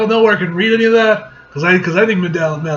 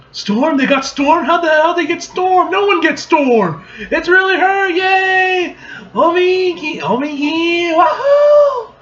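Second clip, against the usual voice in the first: very high, loud fangirl squealing; thrilled fan; 12 semitones higher, 5 dB louder, slower than usual. Acted. Fans astonished that Storm came, squealing with excitement. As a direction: build from disbelief to whooping.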